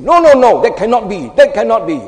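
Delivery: emphatic protest. Acted. Disciple objecting to Jesus foretelling his death, loud protest. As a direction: burst out refusing, high and loud.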